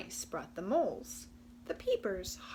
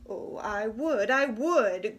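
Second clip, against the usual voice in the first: louder, regretful growl; growling Raccoon; 9 dB louder than usual. Acted. Raccoon torn between party and food, growling. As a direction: growl a reluctant yes. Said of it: "growled Raccoon".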